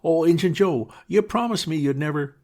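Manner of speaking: high, loud desperate cry cut off; exclaimed. Acted. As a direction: cry out to Injun Joe in panic.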